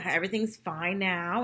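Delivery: normal talking voice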